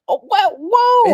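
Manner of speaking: high, drawn-out mock shock; retold astonishment. Recalling the accent reveal, high mock astonishment.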